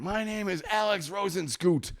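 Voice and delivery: high, slow, mock-proud announcement; proud roommate. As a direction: imitate a roommate proudly declaring himself. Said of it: "Like, he's proud".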